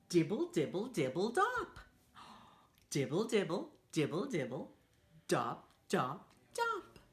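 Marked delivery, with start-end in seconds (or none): none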